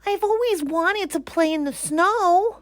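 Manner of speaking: excited. Acted. Bear thinking the soap flakes are snow. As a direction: gasp with wonder.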